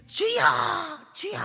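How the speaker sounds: high desperate cry to God; high pleading cry of a falling man. Retold or imitated. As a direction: call out Lord, Lord in panic. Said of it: "외쳤겠지요".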